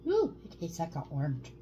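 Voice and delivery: talking while sucking candy; slightly muffled by sweet; candy in mouth. Real, not acted. Seen 0: Both pop small orange candies into mouths near start, then chew.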